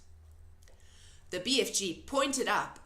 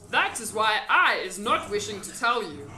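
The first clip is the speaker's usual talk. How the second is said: loud, folksy friendly giant; 8 dB louder than usual. A narrator's reading. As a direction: play the big kind giant.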